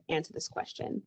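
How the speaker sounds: usual speaking voice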